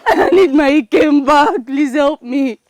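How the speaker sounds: tearful, desperate begging; crying. Acted. Woman begs Ugochi to help save Ikem, crying. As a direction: plead for help through sobs. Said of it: "You have to stop crying".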